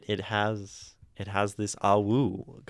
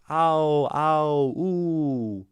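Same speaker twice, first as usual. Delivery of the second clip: higher, louder howl-like ow and ooh; mock wolf howl; 7 semitones higher, 6 dB louder, much slower than usual. Shown as a demonstration. Streamer shows the poem's rhymes as wolves howling, mock howl. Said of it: "all of this is kind of the sounds of howling".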